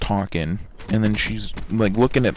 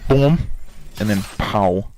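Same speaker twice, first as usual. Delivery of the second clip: game sound effects. Retold or imitated. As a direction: punch out the boom, the pow in an odd voice. Imitating a game kill.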